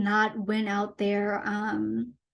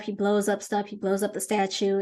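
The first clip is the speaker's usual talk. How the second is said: ordinary talk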